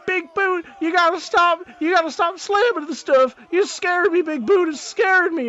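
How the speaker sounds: high, loud whiny voice; scared dragonborn. Acted. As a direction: whine at him, frightened. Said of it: "you just hear him going".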